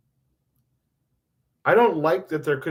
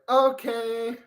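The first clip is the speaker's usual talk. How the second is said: put-on high-pitched kid's "okay"; small child; 8 semitones higher than usual. Child Theo agrees to be taught, high little voice. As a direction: answer like a little kid, pitched way up. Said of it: "I was like".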